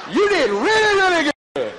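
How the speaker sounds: high, loud, gushing compliment; over-the-top praise. Shown as a demonstration. Speaker models praise for a coworker, hammed-up and gushing. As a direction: blow the praise up big and high. Demonstrating exaggerated praise to audience.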